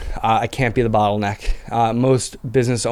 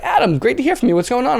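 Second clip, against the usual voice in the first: much higher, a lightly voiced upbeat friend; a hint of a delighted client on the phone; cheerful Lenny; 12 semitones higher than usual. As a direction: greet him like an old pal picking up. Lenny answers the phone, retold.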